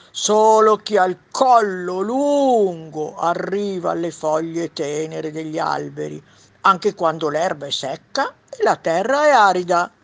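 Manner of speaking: giraffe. Acted. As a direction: contradict them as a long-necked giraffe, loud and stretched. Giraffe voice in read story. (voce forte e allungata da giraffa)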